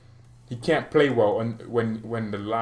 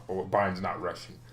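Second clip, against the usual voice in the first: ordinary talk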